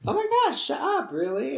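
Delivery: high squealing disbelief falling to a question; high, loud fangirl squeal; excited squeal. Retold or imitated. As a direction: squeal like a starstruck fan. Reacting to free drink offer.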